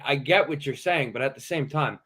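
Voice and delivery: normal talking voice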